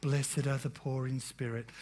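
hushed, low and soft, like a film Jesus; soft movie Jesus. Retold or imitated. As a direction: murmur it gently like a movie Jesus. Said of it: "I don't think Jesus spoke like that".

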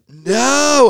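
high, loud disbelieving yelp; shocked. Retold or imitated. Child hearing the facts of life, shrieking in shock. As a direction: squeal it in disbelief.

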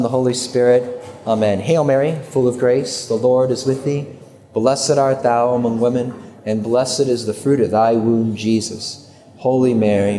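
low, measured recited prayer. Real, not acted. Speaker opening his talk with Hail Mary, praying.

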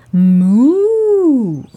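loud drawn-out moo; cow moo. Acted. Cow teaches the kitten.

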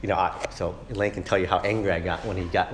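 ordinary delivery

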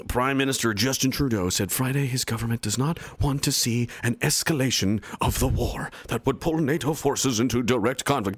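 ordinary delivery

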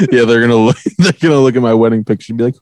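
normal talking voice

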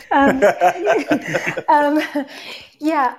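bursts of high laughter, caught off guard; embarrassed laughter. Real, not acted. Guest caught muted, laughing it off to the host. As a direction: laugh it off after forgetting to unmute.

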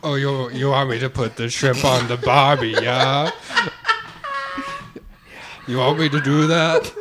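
loud, badly faked Australian; Australian accent. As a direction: ham up a failed Australian accent. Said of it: "you wanna do Australian".

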